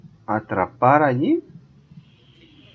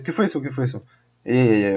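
ordinary talk, nothing marked

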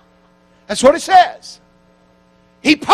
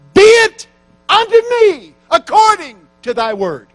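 "Be it unto me" cried out much higher and louder, full of fervour; exalted acceptance; 12 semitones higher, 9 dB louder than usual. Acted. Mary answering the angel's message, exalted and loud. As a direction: proclaim it like a triumphant yes to God.

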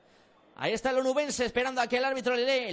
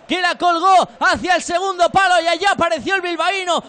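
loud, drawn-out goal call; excited goal commentary; 3 semitones higher, 7 dB louder, slower than usual. Real, not acted. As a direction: belt out the scorer's name. Goal replay call after scoring.

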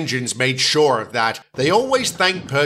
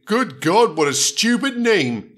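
higher-pitched scornful sneer; contemptuous character jibe; 6 semitones higher, slower than usual. A narrator's reading. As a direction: sneer in mock disbelief. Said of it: "James sneered".

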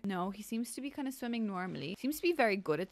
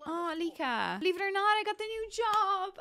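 higher and louder, bursting with happy news; excited sim voice; 10 semitones higher, 4 dB louder than usual. Pretend. A sim tells Alika about the new job, thrilled and higher. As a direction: blurt out the good news like a thrilled neighbour.

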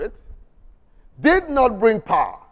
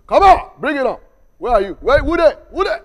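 cocky, higher taunting call; bold challenge; 4 semitones higher, 5 dB louder than usual. Retold or imitated. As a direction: dare them to come, louder. Gehazi emboldened, daring enemies.

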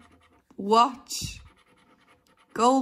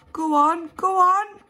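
eager urging; 9 semitones higher, 4 dB louder than usual. Real, not acted. Urging card.

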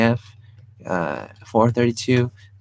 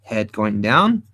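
head going down said lower and slow; falling head; 5 semitones lower, slower than usual. Shown as a demonstration. Teacher showing the pose, voice dropping low and slow. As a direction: let the voice sink slowly with the head. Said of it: "Now watch this".

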